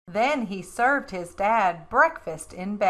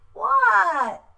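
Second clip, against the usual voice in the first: much higher reply; startled reply; 12 semitones higher, 4 dB louder than usual. A narrator's reading. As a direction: answer the yell. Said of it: "Froggy answers yell".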